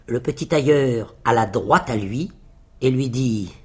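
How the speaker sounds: plain reading voice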